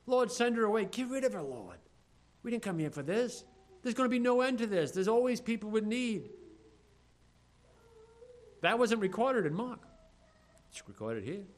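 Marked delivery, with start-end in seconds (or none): 0.1-6.3 annoyed followers wanting her gone; irritated disciples. Retold or imitated. Disciples urging the Lord to send the woman away, raised and irritated. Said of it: "she's crying after us".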